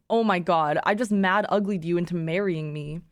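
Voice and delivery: ordinary delivery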